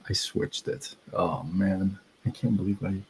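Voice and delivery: soft unvoiced mutter to himself; hushed self-correction; whispered aside. Real, not acted. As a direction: drop to a whisper, embarrassed at the slip. Muttered aside after a mistake.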